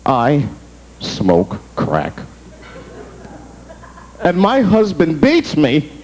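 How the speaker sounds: low, slow mock-tearful confession; a touch of fake weepy ad voice; sad commercial lady. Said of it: "She's like".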